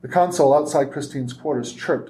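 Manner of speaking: ordinary delivery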